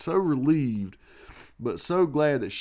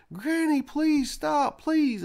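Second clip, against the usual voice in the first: high, louder mock child scream; fake screaming; 4 dB louder, slower than usual. Boy pretending to be beaten, a high loud fake scream. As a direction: scream like you're getting whipped, high. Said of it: "I was screaming at the top of my lungs".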